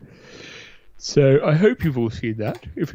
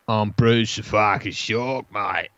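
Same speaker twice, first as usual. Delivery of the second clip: mock Australian shark. Host voices Bruce the shark in a mock Australian accent. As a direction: growl it like a cocky Aussie shark. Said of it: "Yeah, mate".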